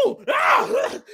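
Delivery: high squealing lady scream; imitated woman's high ooh-ah scream. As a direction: shriek like an excited woman. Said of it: "it was a lady scream".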